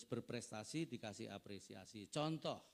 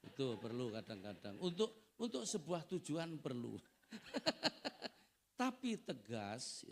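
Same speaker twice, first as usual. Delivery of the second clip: normal talking voice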